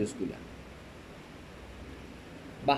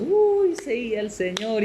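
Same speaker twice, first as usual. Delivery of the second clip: high-pitched, drawn-out devout gushing; mock pious churchgoer; 8 semitones higher, slower than usual. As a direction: gush like an overly pious believer. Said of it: "en una reunión de domingo todos". (imitando a un fiel exageradamente piadoso, agudo)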